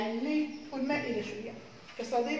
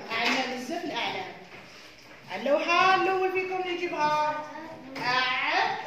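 ordinary delivery

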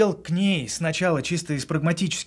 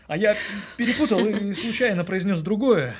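laughing while speaking; 8 semitones higher, 3 dB louder than usual. Acted. Confessing an embarrassing mistake.

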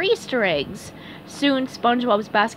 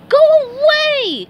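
very high, loud shriek; SpongeBob crying out; 9 dB louder than usual. A narrator's reading. SpongeBob hears Patrick knock at the door, shrieking. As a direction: shriek it to drive him off. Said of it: "cried SpongeBob".

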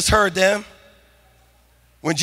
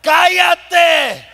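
crowd yelling; 7 semitones higher than usual. Retold or imitated. Crowd silencing the blind men in Spanish, shouted high. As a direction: snap it at him like an angry mob. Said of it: "the crowd yelled at them".

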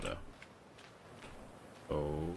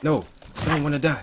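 panicked scream; 9 semitones higher, 8 dB louder, faster than usual. Real, not acted. Attacked, panicking in game.

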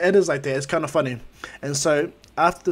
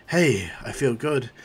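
relieved sigh, slow; 3 semitones lower, slower than usual. Retold or imitated. All Might recovering, feeling good.

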